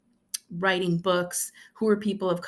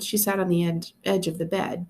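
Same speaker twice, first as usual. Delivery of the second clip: ordinary delivery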